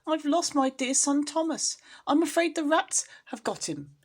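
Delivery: a mother fearing the worst for her son; distraught crying lament; tearful mother cat. A narrator's reading. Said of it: "shedding tears".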